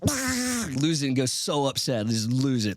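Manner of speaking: a hint of an infuriated cartoon duck losing it; Donald Duck tantrum. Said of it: "That's my best Donald Duck impression".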